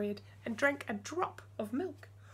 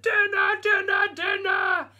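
put-on high, very loud giant-baby shout; 10 semitones higher, 10 dB louder, slower than usual. Huge baby on the roof shouting for dinner, very loud. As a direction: bellow from the rooftop. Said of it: "he shouted".

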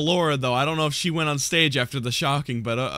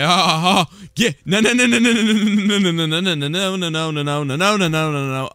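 panicked; 3 semitones higher than usual. Real, not acted. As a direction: beg it not to come. An animatronic is approaching.

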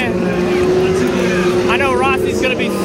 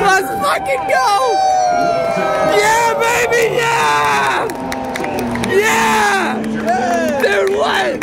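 ecstatic shouting; 11 semitones higher, much slower than usual. Real, not acted. Fan in the stands screaming as Marcus takes the win, ecstatic shout. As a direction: yell your lungs out for the winner.

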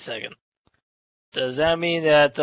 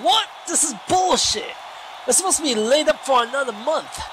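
furious outburst; 9 semitones higher, faster than usual. Retold or imitated. Commentator voicing Kendra blowing a fuse over the doctors, ranting. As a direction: blow a fuse in disbelief. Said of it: "Kendra just blew a fuse and she's saying".

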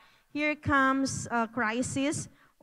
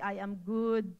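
through tears; 5 semitones lower, 4 dB quieter, slower than usual. Pretend. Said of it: "even in the middle of the crying".

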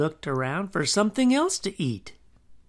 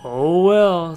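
loud, drawn-out ghostly sigh; a hint of a ghost's reluctant groan; 10 dB louder than usual. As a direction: give in grudgingly, out loud.

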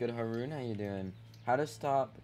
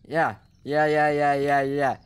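loud, higher gloating chant; gloating yeah yeah; 5 semitones higher, 14 dB louder, slower than usual. Real, not acted. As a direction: rub it in, loud and smug. Gloating at another player.

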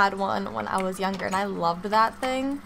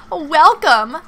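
excited; 5 semitones higher, 9 dB louder than usual. Real, not acted. Reading sign aloud excitedly.

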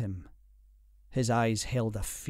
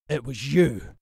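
louder, snarling accusation; controlled angry snarl; 2 semitones higher, 6 dB louder than usual. A narrator's reading. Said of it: "Jack snarled".

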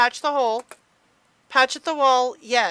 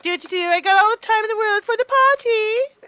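mock carefree hum, higher; carefree singsong; 9 semitones higher, 5 dB louder than usual. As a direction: hum along as if there's all the time in the world. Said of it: "I'm like".

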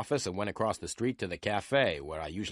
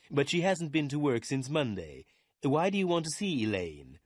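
put-on higher-pitched older office boss; wary lawyer; 6 semitones higher than usual. A narrator's reading. Lawyer Meyer asks why the detective wants Elaine, wary. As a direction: question the visitor in a raised character voice.

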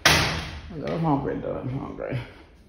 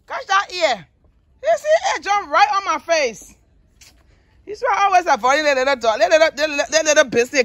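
high excited scolding; 12 semitones higher than usual. Real, not acted. Dog jumped on her face.

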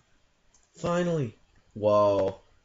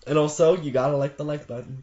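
ordinary talk, nothing marked

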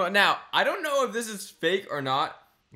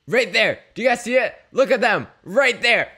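excited; 2 semitones higher, 7 dB louder than usual. Real, not acted. Pointing at photo, loud.

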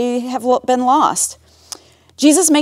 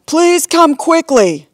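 higher, drawn-out plea; worried messenger; 6 semitones higher, slower than usual. A narrator's reading. Neighbor begging Jesus to come, worried. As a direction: plead for help, slowly and earnestly.

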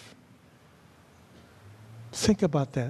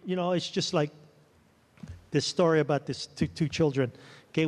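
usual speaking voice

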